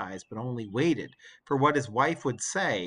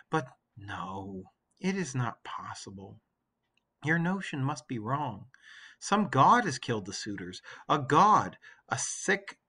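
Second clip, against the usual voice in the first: Penelope, weary. Acted. Penelope refuses to believe.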